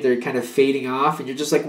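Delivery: ordinary delivery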